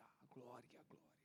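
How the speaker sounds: breathy whisper fading away; whispered glory. Acted. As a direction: breathe "a glória" out to nothing. Sermon climax fading on glory.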